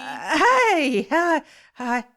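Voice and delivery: high, startled stammer of a greeting. Acted. Host startled by a stranger in his flat, high flustered hi. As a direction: caught off guard, blurt a flustered hello.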